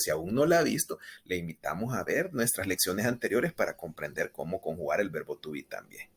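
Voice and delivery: ordinary talk, nothing marked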